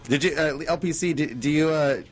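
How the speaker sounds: normal talking voice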